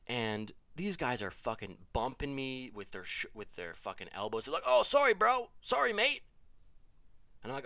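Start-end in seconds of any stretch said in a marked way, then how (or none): 4.7-6.2 loud, high-pitched fake apology; weird disguised voice. Disguised friends bumping him with elbows, weird disguised voice. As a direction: shout it in a silly put-on voice. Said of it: "gentlemen with weird accents".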